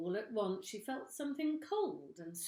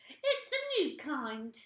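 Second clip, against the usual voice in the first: put-on higher, slow innocent old woman; sly old woman; 8 semitones higher, 8 dB louder, slower than usual. A narrator's reading. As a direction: feign innocence. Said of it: "Missus Twit said".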